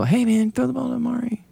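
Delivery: pleading buddy. Retold or imitated. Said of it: "someone just budge him and go".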